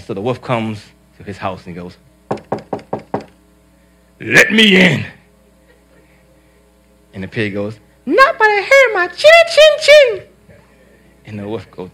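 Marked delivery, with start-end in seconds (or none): 4.2-5.0 louder, higher wolf demand; wolf demands to be let in. Acted. Wolf at the second house. 8.1-10.2 very high, squeaky piglet voice; squeaky little pig. Acted. Pig answers the wolf.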